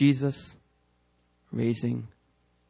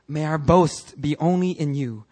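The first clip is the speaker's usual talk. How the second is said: usual speaking voice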